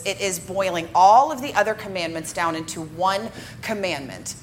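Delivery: ordinary delivery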